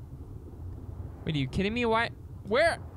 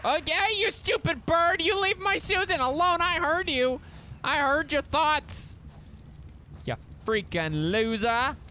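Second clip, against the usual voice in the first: higher, mock-furious telling off; mock angry yelling; 7 semitones higher than usual. Gamer yelling at a bird that threatened Susan, mock fury. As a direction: scold the bird, half joking.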